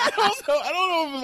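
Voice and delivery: high giggling through words; laughing. Real, not acted. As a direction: laugh while talking.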